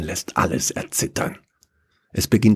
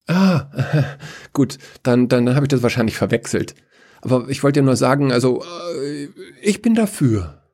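higher, fast, nervous backtracking; sheepish Chaos; 6 semitones higher than usual. Acted. Chaos corrected by Death, sheepish. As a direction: laugh it off, then declare yourself.

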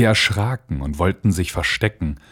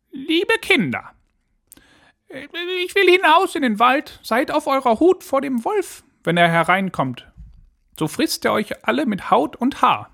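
very high-pitched mother goat voice; high, put-on nanny goat warning her little ones. A narrator's reading. Mother goat warns her children about the wolf, high put-on voice.

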